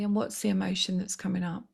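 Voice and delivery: usual speaking voice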